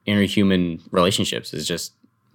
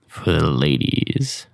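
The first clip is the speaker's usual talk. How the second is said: announcer voice; 4 semitones lower, much slower than usual. Pretend. Announcing the next question.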